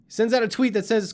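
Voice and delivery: ordinary delivery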